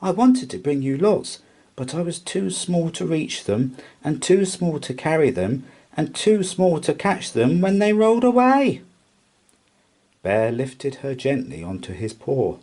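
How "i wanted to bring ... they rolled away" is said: earnest mouse. A narrator's reading. Milly tells Bear.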